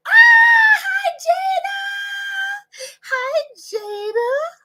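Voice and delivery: high, drawn-out squeal of hello. Real, not acted. Vlogger greeting a viewer's daughter, squealing high. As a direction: sing out the name high and long.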